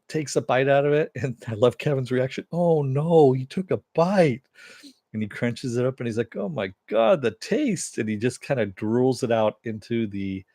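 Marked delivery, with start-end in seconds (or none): 2.5-4.4 higher, louder mock dismay; Kevin's dismay. Host voicing Kevin dismayed as Tick bites starfish, higher and louder. Kevin reacts to gross bite. 6.4-8.0 much higher mock disgust; Tick disgusted. Host voicing Tick disgusted by the taste, much higher.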